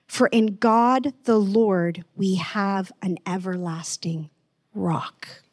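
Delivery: measured, solemn reading of scripture. Acted. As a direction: read the verse with reverence.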